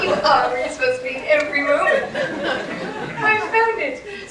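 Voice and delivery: high, loud triumphant exclaiming; gleeful amazement. Retold or imitated. Driver discovering the bookstore out front, gleeful amazement. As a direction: crow the mantra in hammed-up delight. Gleeful mantra after finding store.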